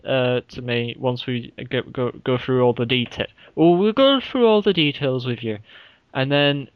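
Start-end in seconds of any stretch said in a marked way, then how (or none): 3.5-5.6 lightly Irish lilt, a bit louder; a hint of an Irish host explaining details; mock Irish voice, slipped in by accident; accidental Irish accent. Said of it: "I don't know why that went Irish".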